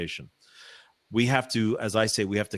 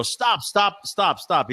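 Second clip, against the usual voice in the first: mock urgent halt, raised pitch; lightly acted call to stop; 9 semitones higher, 3 dB louder, much slower than usual. Singer halting his orchestra mid-show, mock urgent. [name] halts orchestra in story.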